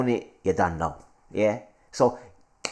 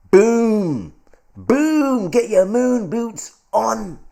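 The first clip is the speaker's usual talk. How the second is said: loud, high, drawn-out hype cry; hyped shout; 12 semitones higher, 6 dB louder, much slower than usual. Real, not acted. Host celebrating a crypto pump to viewers, hyped shout. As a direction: belt it out like a celebration.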